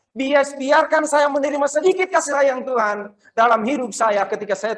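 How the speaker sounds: high, loud, desperate-sounding prayer; impassioned plea. Retold or imitated. Samaritan leper begging Tuhan among the others, loud high pleading. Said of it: "Dari hatinya mungkin dia bilang".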